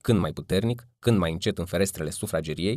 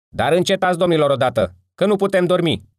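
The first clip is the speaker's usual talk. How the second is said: much higher and louder, furious yell; angry shout; 10 semitones higher, 7 dB louder than usual. A narrator's reading. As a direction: shout them down so you can sleep. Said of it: "strigă cineva".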